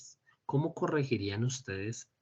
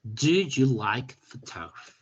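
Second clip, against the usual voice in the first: slow model sentence; 4 dB louder, slower than usual. Shown as a demonstration. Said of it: "Ujú".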